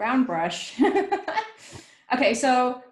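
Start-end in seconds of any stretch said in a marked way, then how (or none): 0.8-1.5 high laugh. Real, not acted. Laughs at one brush.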